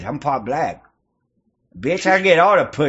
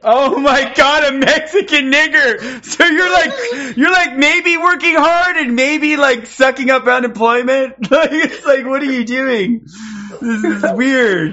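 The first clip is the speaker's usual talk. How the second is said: higher and louder, half laughing; laughing mockery; 8 semitones higher, 4 dB louder than usual. Real, not acted. Streamer ridiculing a stranger on camera, loud laughing taunts. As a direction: ridicule him while cracking up. Mocking the mixed-race stranger.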